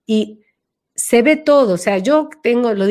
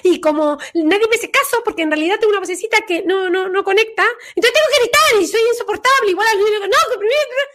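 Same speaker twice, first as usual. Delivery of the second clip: much higher and fast, a squeaky little voice; tiny childish voice; 12 semitones higher, faster than usual. Shown as a demonstration. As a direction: play the small voice nobody listens to. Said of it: "tengo la vocecita así".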